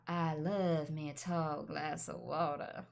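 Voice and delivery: put-on slow, low American-accented drawl. Tick she voices to a friend, a slow American drawl. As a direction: drawl it out like a Southern flirt. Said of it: "I have this tick where I'm like".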